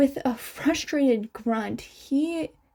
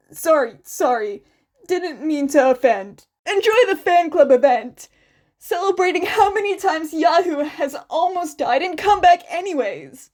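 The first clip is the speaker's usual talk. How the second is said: louder, quick sarcastic brush-off; snide apology; 5 semitones higher, 6 dB louder, faster than usual. A narrator's reading. The fan retreats from Izuku, loud and snarky. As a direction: back off with a sarcastic jab.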